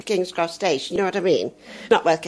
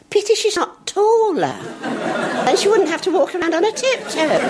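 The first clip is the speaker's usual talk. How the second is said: put-on naive little girl; 11 semitones higher than usual. Little girl in the wings pitying the ballerina. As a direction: think it like a puzzled little girl. Childhood thought about ballerina.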